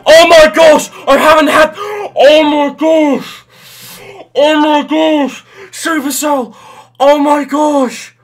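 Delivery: screaming with joy, very high and loud, slow stretched; overjoyed shriek at a rare chest; ecstatic screaming. Real, not acted. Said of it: "I can't speak right now".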